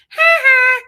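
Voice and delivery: high, loud cartoon bully "ha ha"; squeaky taunting cartoon cackle; Simpsons mocking laugh. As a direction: jeer it like a cartoon kid pointing and laughing. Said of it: "like a Simpsons cartoon going".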